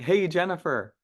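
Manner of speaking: put-on high, bright hello to an old friend; friendly greeting. Shown as a demonstration. Trainer demos the right way to greet a client. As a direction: greet her like a longtime client. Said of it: "We're looking on like".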